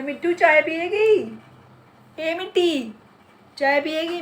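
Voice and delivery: high, loud sing-song call to a pet; sweet high pet-talk call; calling the parrot. Real, not acted.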